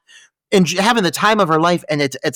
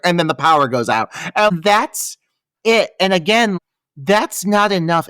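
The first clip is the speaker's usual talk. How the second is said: ordinary talk, nothing marked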